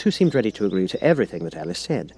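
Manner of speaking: plain reading voice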